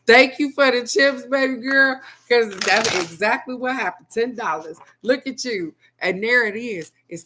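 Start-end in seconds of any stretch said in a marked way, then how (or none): none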